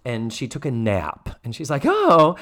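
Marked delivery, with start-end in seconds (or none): 1.8-2.4 high, loud startled oh; a sudden flustered gasp of oh. Retold or imitated. Imitating Mrs. Garrett startled.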